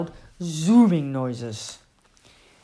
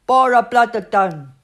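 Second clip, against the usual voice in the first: put-on loud toddler gibberish; toddler language; 5 semitones higher, 9 dB louder than usual. A narrator's reading. Annie Rose answering in her own language, loud babble. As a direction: nonsense words babbled out like a baby. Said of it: "in Annie Rose language".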